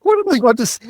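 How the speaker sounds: high, loud disbelief; incredulous. Retold or imitated. Retold inner thought, incredulous.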